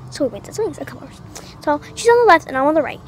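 ordinary talk

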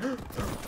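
short, quiet struggling gasp; gasping for air. Retold or imitated. Storyteller re-enacting his childhood breathing attack, gasping for air. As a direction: imitate a starved gasp for air. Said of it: "as I'm gasping for air".

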